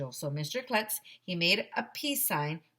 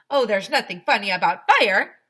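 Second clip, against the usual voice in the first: firefighter lady; 3 semitones higher, 12 dB louder than usual. Acted. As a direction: chirp it like a cheerful lady. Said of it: "said Missus Meyer".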